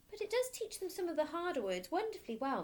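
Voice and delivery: gentle teacher. Acted. Teacher defending her method to the headmistress, gently. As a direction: plead kindly like a young teacher. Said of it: "Miss Honey said".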